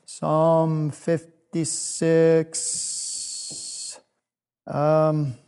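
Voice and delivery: very slow, stretching out each word; drawn out while searching. Real, not acted. Speaker finding a Bible verse for children, slow and stretched out. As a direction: stall on it, holding the final hiss of six.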